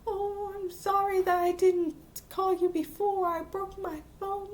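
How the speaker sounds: high-pitched, imitated crying plea; mock tearful apology. Girl apologizes to her friend at school, mock tearful apology. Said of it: "she cries to her".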